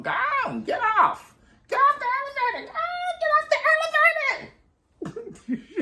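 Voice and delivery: mock elevator man shrieking high and loud; hammed-up high-pitched elevator attendant shouting get off; shooing elevator man.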